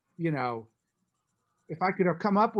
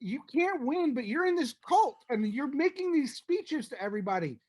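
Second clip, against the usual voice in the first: incredulous lecture; 9 semitones higher than usual. Retold or imitated. Lecturing John about the cult.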